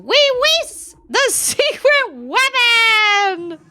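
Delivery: high, loud, drawn-out cry; triumphant little mouse shouting her reveal; high slow laughing shout of the secret weapon; mouse yelling. Retold or imitated. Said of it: "And she says".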